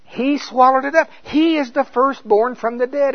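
high, loud full-force preaching; fervent preaching. Acted. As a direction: preach it out with rising fire.

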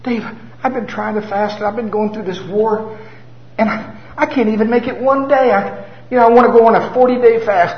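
high, distressed pleading; lightly voiced upset lady; condemned woman. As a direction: pour out guilt in a rush. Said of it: "She said".

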